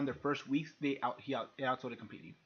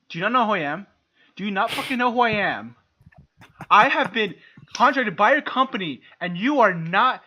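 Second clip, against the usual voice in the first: loud indignant outrage, pitched up; hammed-up furious boss; mock angry rant; 5 semitones higher, 6 dB louder than usual. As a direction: play the entitled big shot chewing someone out. Acting out an angry confrontation.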